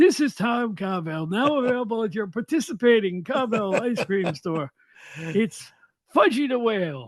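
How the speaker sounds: lightly voiced gravelly pitchman; a hint of a gruff old ice cream man; gravelly ad announcer. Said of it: "He had this gravelly voice".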